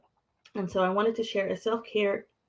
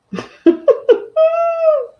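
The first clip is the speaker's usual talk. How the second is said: high, loud burst of laughter; excited laugh; 11 dB louder than usual. Real, not acted. Host starting her livestream, loud high laugh.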